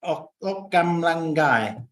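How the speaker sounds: very slow drawn-out "Okam langai"; slow Thai. Shown as a demonstration. Vlogger repeating the Thai word, very slowly. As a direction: stretch "Okam langai" syllable by syllable. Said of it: "one I learned the other day".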